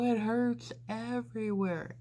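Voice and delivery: high, whining complaint; pained whine. Retold or imitated. Patient answering nurses in pain.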